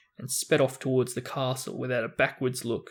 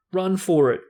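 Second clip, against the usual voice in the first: higher, much louder command; shout; 5 semitones higher, 6 dB louder than usual. Acted. Ron throwing his weight at the door, shouting. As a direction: shout for escape. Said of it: "Ron shouted".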